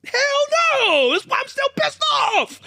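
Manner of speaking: shouting that the snub still stings; outraged shout. Real, not acted. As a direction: yell it like the grudge is fresh. Still angry about old snub.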